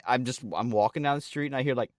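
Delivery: ordinary delivery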